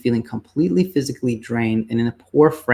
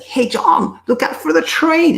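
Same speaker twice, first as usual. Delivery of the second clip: high raised warning call to a friend; calling louder; 4 dB louder than usual. Pretend. You warning your friend John on the tracks, high urgent call. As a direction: call out higher, urging him to look up. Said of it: "and he's like".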